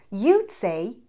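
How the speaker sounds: usual speaking voice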